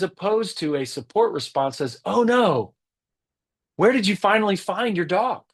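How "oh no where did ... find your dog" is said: much higher voice, open and sympathetic; caring concern. Acted. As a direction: sound truly interested in his lost dog. Support response example, caring reply.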